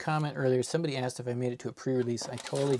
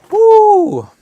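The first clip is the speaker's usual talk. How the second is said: much higher, much louder whoop; woo cheer; 18 dB louder than usual. Real, not acted. Streamer celebrates finishing the set, whoop. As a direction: let out a victory whoop.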